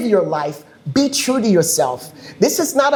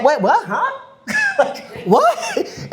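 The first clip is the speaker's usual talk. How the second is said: high, giggling wait what huh; laughing disbelief; slower than usual. Retold or imitated. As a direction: laugh through the confused double take. Mock confusion at binary culture.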